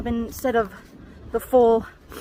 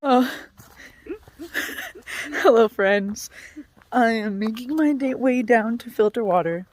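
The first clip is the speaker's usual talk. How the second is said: ordinary talk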